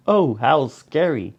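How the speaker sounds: usual speaking voice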